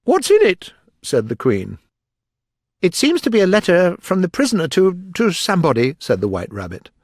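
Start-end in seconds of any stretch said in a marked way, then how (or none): 0.0-0.7 very high, loud question; demanding Queen. A narrator's reading. Queen questioning the Rabbit, high and demanding. 2.8-6.0 high, loud, halting report. A narrator's reading. Rabbit reports to the court.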